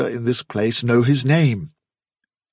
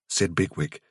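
usual speaking voice

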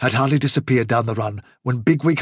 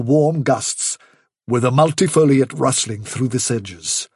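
ordinary delivery, nothing marked

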